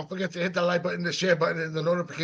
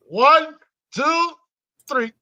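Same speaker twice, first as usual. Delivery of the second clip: excited countdown; 8 semitones higher, 6 dB louder, much slower than usual. Real, not acted. Hyped countdown to big screen.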